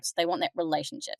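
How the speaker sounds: ordinary talk, nothing marked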